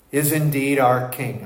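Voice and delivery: ordinary talk, nothing marked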